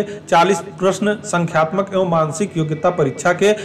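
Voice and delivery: ordinary talk, nothing marked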